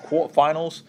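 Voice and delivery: ordinary talk, nothing marked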